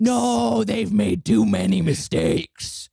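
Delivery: slow, loud angry insistence; angry shout. Real, not acted. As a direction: shout it, fed up. Heated argument about billing.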